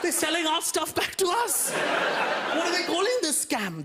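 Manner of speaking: high, hammed-up indignant shout; mock outrage. Indians discovering their own tea sold back, mock outraged shout. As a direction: cry treachery with fake fury.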